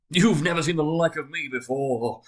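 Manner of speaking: loud, high jovial boast; hearty exclamation. A narrator's reading. Spirit boasting to awed Scrooge, loud and jovial. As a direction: boast like a jolly giant. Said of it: "exclaimed the spirit".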